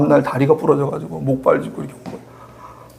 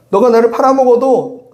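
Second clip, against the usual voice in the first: 팔아먹어도 pushed higher and louder, falling at the end; raised, insistent preaching voice; emphatic repeat; 8 semitones higher, 5 dB louder than usual. Acted. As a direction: lean hard into 팔아먹어도 like a preacher pressing the point. Repeats Jesus' words, stronger.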